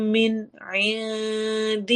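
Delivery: من عند very slow, noon of من clear, noon of عند hidden; clear then hidden noon. Shown as a demonstration. Teacher reciting for the class. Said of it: "عند میں نون کی آواز کو چھپائیں گے".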